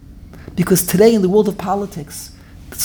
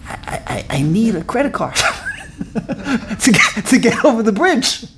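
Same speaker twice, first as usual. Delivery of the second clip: laughing; 2 semitones higher than usual. Real, not acted. Laughing joke about credit card.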